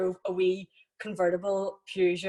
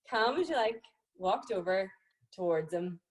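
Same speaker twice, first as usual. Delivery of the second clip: ordinary talk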